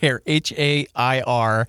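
slow letter by letter spelling. Shown as a demonstration. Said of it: "Can you use it in a sentence?".

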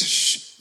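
unvoiced "shh" as if hushing a baby; mock shushing. Parent with a crying newborn, imagined shushing. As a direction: hush them with a long hiss. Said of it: "Nobody says".

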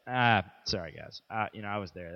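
low, soft mumbled excuse; quietly mumbled mock apology; mock sheepish apology. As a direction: toss off a sheepish sorry under your breath. Mock apology voiced in a story.

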